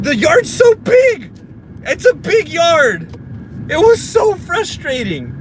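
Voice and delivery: high, whiny exasperated rant; frustrated. Real, not acted. Overwhelmed by the big yard, frustrated. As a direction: wail it high, stretching the words. Ranting about huge yard.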